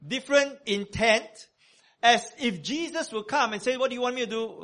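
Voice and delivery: loud, high preaching at full force; full-voiced sermon delivery. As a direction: preach it out to the congregation.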